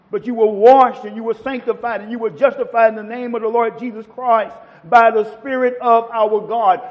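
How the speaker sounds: ordinary delivery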